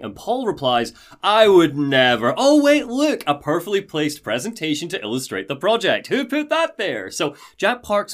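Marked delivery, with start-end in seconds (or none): 1.2-2.3 slow, louder feigned denial; hammed-up "who, me?" denial; mock innocence. 2.4-7.1 high, hammed-up discovery; mock amazement, pitch leaping up at the ends; feigned surprise. Feigned surprise at placed presentation.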